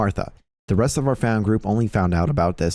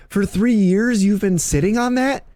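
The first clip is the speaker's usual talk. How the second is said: pitched way up, exasperated disbelief; exasperated high outburst; 12 semitones higher, 3 dB louder than usual. Retold or imitated. Telling his paintball teammate he's been sitting on that, high exasperated squeal.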